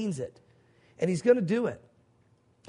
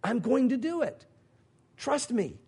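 "going to do it" lifted higher and firm; firm, raised emphasis on "I'm going to do it"; emphatic promise; 4 semitones higher than usual. Acted. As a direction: stress "going", pitched up, as a solemn assurance. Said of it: "this double emphatic".

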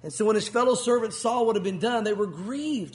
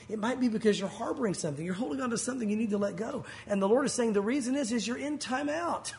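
normal talking voice

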